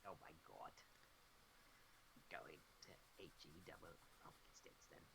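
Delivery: hushed unvoiced aside; soft low whisper. Real, not acted. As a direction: whisper it like a secret. Whispered joke about swearing.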